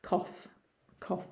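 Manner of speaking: "cough" short, with a final F; said as COF. Shown as a demonstration. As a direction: say "cough" as if spelt C-O-F. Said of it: "think of it as a C O F".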